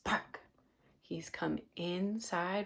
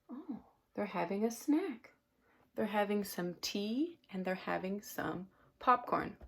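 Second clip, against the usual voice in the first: ordinary delivery, nothing marked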